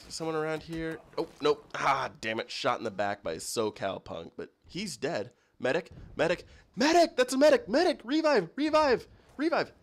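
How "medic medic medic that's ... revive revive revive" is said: frantic high yelling for a medic, building; man down shout. Acted. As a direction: scream for a medic, getting higher and louder. Calling for a medic after being shot.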